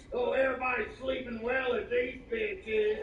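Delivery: high, slow mock whine; forum complainer. Message board poster complaining, high slow mock whine. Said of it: "people's all pissed off on the message board".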